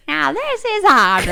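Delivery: high, slow hammed-up Southern twang; mock Southern accent. Teasing Audrey's Southern episode voice in mock Southern. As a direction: tease her with a put-on Southern drawl. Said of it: "Audrey has to talk like".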